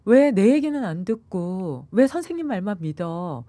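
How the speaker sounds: put-on slow, high, tearful child's plea; child crying. Fifth grader crying to his mother, slow and tearful. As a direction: plead with mom through tears, slowly. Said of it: "막 울면서 하는 얘기가".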